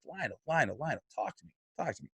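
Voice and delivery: softly soothing an upset friend; retold, hushed and soothing to a friend; calming him down. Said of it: "I calm him down".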